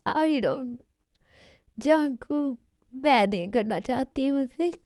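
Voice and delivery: halting, tearful refusal; acted weepy protest; crying. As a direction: sob between the words. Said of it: "अब क्यों रो रही हो".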